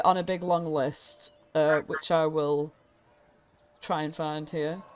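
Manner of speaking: normal talking voice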